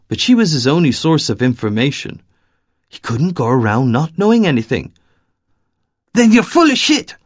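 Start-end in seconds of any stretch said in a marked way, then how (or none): 6.1-7.2 high, loud, contemptuous spit; spat in contempt. Acted. Angry woman who refuses to believe him, spitting contempt. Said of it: "she spat".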